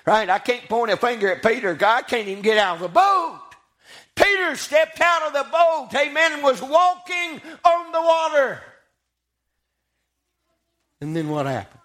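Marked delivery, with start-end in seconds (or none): 4.2-8.6 high, sustained preacher's proclamation; full-force preaching. Real, not acted. Preacher tells of Peter stepping from the boat, preaching hard. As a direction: proclaim the miracle in a raised cadence.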